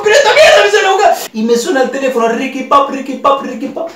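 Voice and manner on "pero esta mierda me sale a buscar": higher and fast, laughing; laughing panic. Retold or imitated. Realizing the man is coming for him, fast and laughing. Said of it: "Y digo".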